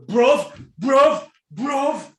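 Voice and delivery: loud, high repeated outburst; exasperated shouting. Real, not acted. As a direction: punch out each word in disbelief. Exclaims in disbelief at his rival.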